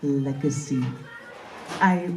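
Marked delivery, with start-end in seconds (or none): none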